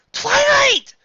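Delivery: high loud call. Acted. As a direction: call her name in alarm. Calling out.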